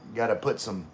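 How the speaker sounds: ordinary talk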